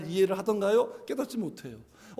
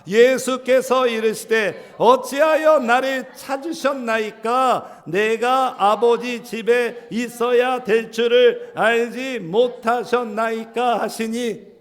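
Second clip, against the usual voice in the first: slow, loud unison reading; loud slow recitation; scripture reading; 7 dB louder, slower than usual. Acted. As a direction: read Jesus' answer slowly together. Congregation reads verse aloud.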